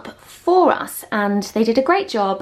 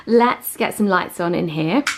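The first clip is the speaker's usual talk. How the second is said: normal talking voice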